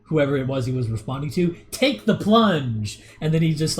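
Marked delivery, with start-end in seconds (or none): 1.7-3.0 drawn-out, dopey take the plunge; stupid slow delivery. Retold or imitated. Streamer imitating a YouTuber goading a debate opponent, slow and dopey. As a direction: stretch it out dumbly, slow. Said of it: "he says it in, like, a stupid way".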